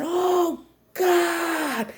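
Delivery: very high, stretched-out groan; mortified wail. Retold or imitated. Girl overheard by her crush, mortified wail. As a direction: wail it in acted embarrassment. Mortified at mom's shouted words.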